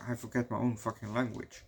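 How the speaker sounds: ordinary talk, nothing marked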